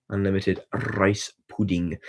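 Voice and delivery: slow, with a rolled R on "rice". Shown as a demonstration. Posed with McCoy, saying this for cheese, rolled R. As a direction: roll the R of "rice", taking it slowly. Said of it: "my ability to roll R's".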